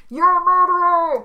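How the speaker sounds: high, loud repeated accusation; accusing shout. Pretend. Captive shouting over the villain, high loud yell. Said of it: "I'm just shouting".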